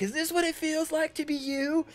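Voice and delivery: very high, far-off squeal; high squeaky voice. Acted. GM voices Skullface asking Max while getting shocked, squeaking high. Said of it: "and you're like".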